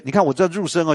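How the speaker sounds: usual speaking voice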